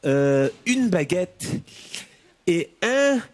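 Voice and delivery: put-on lightly French-accented bakery order, slowing at the baguette; mock French order. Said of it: "Do they go into the boulangerie?".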